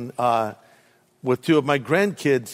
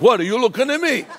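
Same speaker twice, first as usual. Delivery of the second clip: fast, higher New York tough-guy challenge; 7 semitones higher, faster than usual. Retold or imitated. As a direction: bark it like a pushy New Yorker. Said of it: "In New York City".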